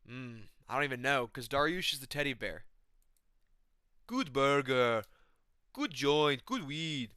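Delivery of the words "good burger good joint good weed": put-on stoned fighter voice; Shamil Gaziev. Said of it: "Shamil Gaziev".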